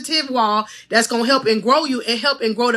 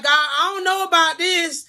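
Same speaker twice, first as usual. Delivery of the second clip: higher, pleading prayer to God; mock doubtful prayer; 7 semitones higher than usual. Coach imitating believers praying, higher pleading doubt. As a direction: voice a worried plea upward. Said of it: "when we be like".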